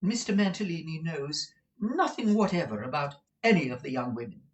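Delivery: high-pitched insistence; prim, jealous madam; firm Madame Mantalini. Acted. Wife denies her husband knows the workers, high and firm.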